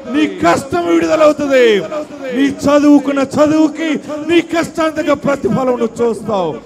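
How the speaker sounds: high, loud shouted sermon; fiery revival preacher shouting deliverance; full-force preaching. Acted.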